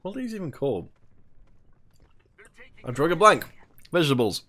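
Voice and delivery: talking with a mouthful; mouth full. Real, not acted. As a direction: speak around a mouthful of food. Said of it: "successfully got a mouthful of".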